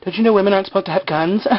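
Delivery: high-pitched imitation of panic; hammed-up alarmed pleading; mock freaking out. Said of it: "But Kate's, like, freaking out".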